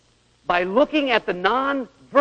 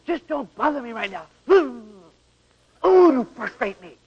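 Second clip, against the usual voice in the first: frustrated wife; 5 semitones higher than usual. Retold or imitated. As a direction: push him off, drawing it out. Wife frustrated, pushing away.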